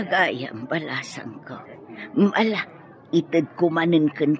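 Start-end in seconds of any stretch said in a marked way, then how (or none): 0.1-1.6 put-on hushed pity for the girl; whispered sympathy to the orphaned girl; tender whisper. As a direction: breathe it softly, moved.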